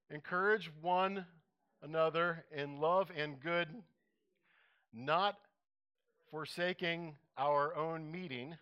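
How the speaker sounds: slow, measured reading of scripture. Acted. Pastor reading Hebrews to the congregation, slow and measured. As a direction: read each phrase deliberately with pauses. Reads Hebrews aloud slowly.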